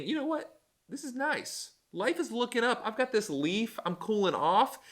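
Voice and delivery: high, soft and dreamy relief; high, hushed voice of a relieved Jonah; content Jonah. Retold or imitated. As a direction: sigh out the comfort like a man in the shade. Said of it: "that is what Jonah, Jonah's kind of seeing".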